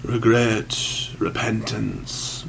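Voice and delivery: slow, soft priestly murmur. A narrator's reading. Priest gently prompting the sinner, murmured. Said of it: "murmured the priest".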